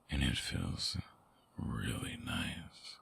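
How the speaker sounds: soft, intimate confession; hushed, affectionate admission; tender whisper. Acted. Holding the listener's hand, tender.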